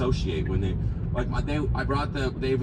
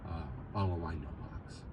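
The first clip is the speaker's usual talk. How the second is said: ordinary talk, nothing marked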